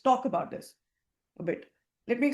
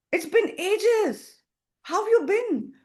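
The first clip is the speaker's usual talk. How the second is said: higher and louder, very slow; energetic, upbeat; 9 semitones higher, 4 dB louder, slower than usual. Shown as a demonstration. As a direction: drag out the warm reunion. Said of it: "You see the tone, the energy?".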